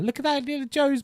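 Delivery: amused high-pitched repeat; amused squeal. Real, not acted. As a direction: squeal "Look at that" in giddy disbelief. Amused repeat at old video.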